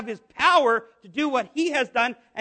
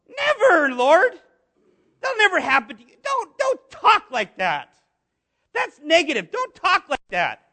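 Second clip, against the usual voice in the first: hammed-up indignant objection; emboldened Peter; 4 semitones higher, 4 dB louder than usual. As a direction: scold with mock alarm, stretching the words. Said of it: "So he feels emboldened".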